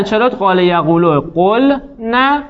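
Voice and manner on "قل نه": قل نه drawn out slowly with a break between قل and نه, pitch lifted; slow, split word. Shown as a demonstration. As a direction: spell out قل نه syllable by syllable, very slowly, for students to copy. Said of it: "لذا قلنه رو بگو".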